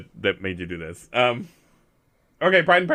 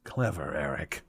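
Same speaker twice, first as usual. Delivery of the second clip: much lower and softer, half under breath; muttered clever; 9 semitones lower, 13 dB quieter, slower than usual. Acted. Character impressed by the hidden door, low and soft. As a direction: murmur it low to yourself.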